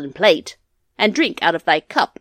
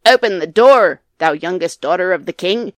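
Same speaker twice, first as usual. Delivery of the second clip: calling out; 16 dB louder than usual. A narrator's reading. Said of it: "and a voice said".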